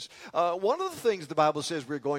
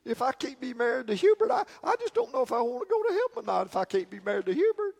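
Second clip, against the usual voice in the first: put-on higher, fast fretful old lady; lady's worried plea; 6 semitones higher, faster than usual. Preacher voicing a widow fretting about heaven, anxious higher plea. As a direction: plead like an anxious widow in a joke.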